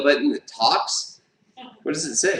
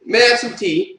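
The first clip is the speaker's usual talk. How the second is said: a light British belly-button voice, higher; mock-posh British request, pitched up a little; British accent; 7 semitones higher than usual. Belly button asking for tea, hinted British accent. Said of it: "if he had a British accent".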